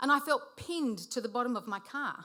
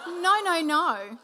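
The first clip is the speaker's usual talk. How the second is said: emphatic denial; 5 semitones higher, 7 dB louder, slower than usual. Retold or imitated. Dismissing the kidnapping idea.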